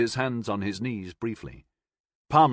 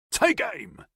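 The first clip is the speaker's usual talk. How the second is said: high, breathy shouted command; shouted order. A narrator's reading. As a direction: call the order down the line. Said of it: "one of them shouted".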